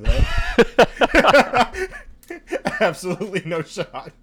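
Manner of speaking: high, loud words breaking into laughter; laughing disbelief. Real, not acted. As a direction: crack up while flatly refusing to believe it. Cracks up at friend's pronunciation claim.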